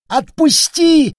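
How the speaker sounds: high, loud desperate shriek; panicked squeal. Acted. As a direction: scream to be let go. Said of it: "голос вампира срывался на визг".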